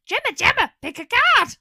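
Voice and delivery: loud, high call across the shop. Acted. Calling Gemma over from the mushroom tea stand, loud and high. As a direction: shout for her friend to come pick a card.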